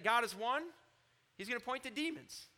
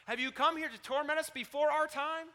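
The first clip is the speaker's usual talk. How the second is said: loud, raised-pitch demon outcry, retold; crying out; 5 semitones higher, 6 dB louder than usual. Demons confronting the Son of God, imitated loud outcry. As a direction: cry it out as the demons would, imitating them. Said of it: "Demons possessing him cried out".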